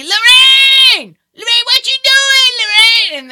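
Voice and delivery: very high, drawn-out holler; piercingly high mimicked street yell; imitated yelling. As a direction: shout a name across the street, stretched long. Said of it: "he starts yelling over me".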